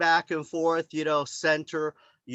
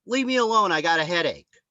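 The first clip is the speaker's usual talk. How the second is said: mock annoyed brush-off; 3 semitones higher, 4 dB louder than usual. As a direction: shoo the pest away. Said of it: "she says".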